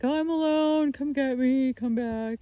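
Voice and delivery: panicky dog voice. Retold or imitated. Owner voicing Cora waking up alone, mildly whiny. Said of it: "It was very panicky".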